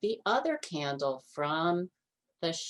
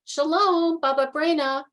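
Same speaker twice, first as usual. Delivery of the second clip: much higher, louder greeting; cheerful friends; 10 semitones higher, 7 dB louder than usual. A narrator's reading. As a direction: call out a happy hello.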